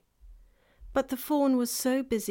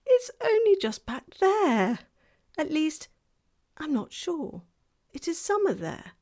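put-on girl's voice; 8 semitones higher, 5 dB louder than usual. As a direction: explain like an eager young girl. Lucy explains, high girl voice.

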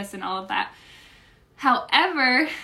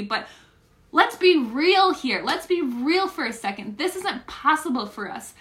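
higher, louder, upset venting; frustrated near tears; 7 semitones higher, 4 dB louder than usual. Retold or imitated. Retelling to viewers her despair over the price, pitch higher and louder. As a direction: rant it out like you're close to tears. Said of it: "I'm like".

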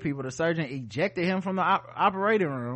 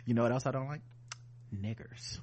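much lower, much softer, calm clinical murmur; flat, quiet operating-room voice; deadpan surgeon calmly asking for tools; 10 semitones lower, 14 dB quieter than usual. Pretend.

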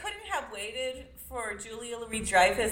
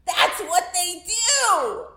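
higher, louder, drawn-out exclamation; mock amazed realisation; 6 semitones higher, 4 dB louder, slower than usual. Whole audience suddenly getting the joke, in exaggerated amazement. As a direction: build to a big, stretched-out mock revelation.

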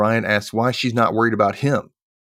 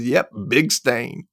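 deadpan; much slower than usual. Retold or imitated. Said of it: "She goes".

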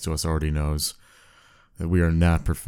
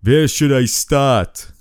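put-on punchline in a hammy Austrian accent; Viper voice; 7 semitones higher, 5 dB louder than usual. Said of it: "replied the voice".

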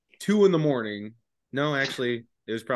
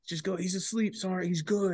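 much higher, soft squeaky voice; squeaky friend covering for him; high mock buddy voice; 9 semitones higher, 5 dB quieter than usual. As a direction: play the nervous buddy in a high voice.

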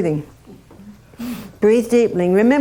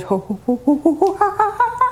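rising cackling ho ho ha ha climbing much higher; kookaburra call; 8 semitones higher, faster than usual. Retold or imitated. Showing the counsellor how the Australian bird laughs, rising cackle. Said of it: "It just goes".

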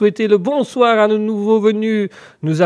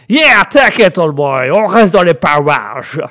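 put-on loud, drawling American accent; American character; 4 dB louder, slower than usual. American alter ego reassures the host, loud American accent. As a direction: sign off as a brash American buddy. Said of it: "Merci beaucoup, l'Américain".

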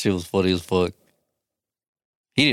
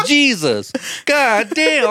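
imitating a very high-pitched cry; loud, high outburst; much slower than usual. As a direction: push the exclamation as high as it will go. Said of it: "as high as he could go".

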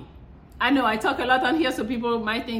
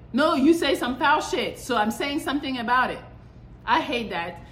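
ordinary talk

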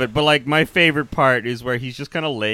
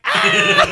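high-pitched mock scream breaking into laughter; imitated scream; 4 dB louder than usual. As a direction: scream like a man running into a villain. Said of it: "he's just screaming like".

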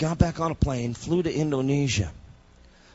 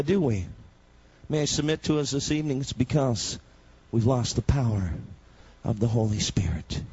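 ordinary delivery

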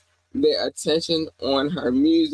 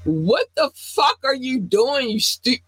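exasperated outburst; 9 semitones higher than usual. Real, not acted. Ranting at the woman.

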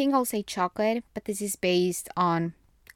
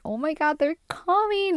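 much higher, thrilled "oh my God"; a burst of excited joy about family arriving; excited squeal; 10 semitones higher than usual. Retold or imitated. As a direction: gush with delight at the news. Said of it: "I get so excited about eating out".

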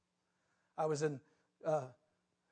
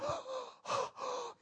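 sharp high gasp of pain; 6 dB quieter than usual. Retold or imitated. Preacher acting out being hit by the ball, pained gasping. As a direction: gasp as if just struck.

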